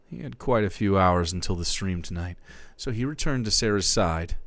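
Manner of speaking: ordinary delivery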